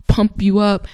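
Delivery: normal talking voice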